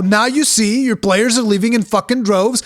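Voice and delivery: ordinary talk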